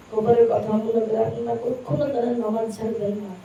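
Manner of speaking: ordinary talk, nothing marked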